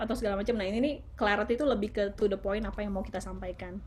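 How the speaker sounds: usual speaking voice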